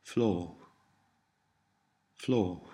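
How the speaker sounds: Fló with a long, continental o. Shown as a demonstration. Tutor gives learners the word for child, Fló with long o. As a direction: draw out the o in Fló. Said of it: "Notice the long or sound".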